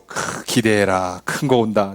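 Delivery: low, slow, smug promise; teasing uncle. Retold or imitated. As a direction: tease the kid about a big gift. 선물 준비하며 으스대는 어른. (조카에게 낮고 느리게 으스대는 삼촌)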